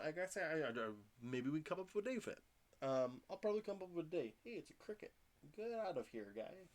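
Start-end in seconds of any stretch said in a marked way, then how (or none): 4.4-6.7 higher, softer startled shoo; startled by a cricket, shoo it off; sudden high mutter at a bug; surprised at a bug. Real, not acted.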